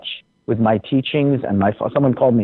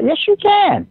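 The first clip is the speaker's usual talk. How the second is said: excited yes. Real, not acted. Emphatic answer to own question.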